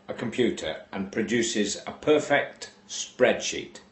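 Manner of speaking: ordinary delivery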